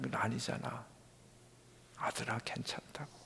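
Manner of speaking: hushed fatherly reassurance; gentle consolation. Retold or imitated. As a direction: reassure tenderly with long pauses, sinking to a whisper. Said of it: "제 마음에 주시는 한마디 위로".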